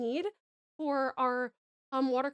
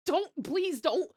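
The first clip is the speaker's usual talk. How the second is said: ordinary talk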